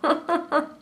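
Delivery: high giggle at herself; can't stop giggling; breaking character giggling. Real, not acted. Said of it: "Can't stop laughing at myself".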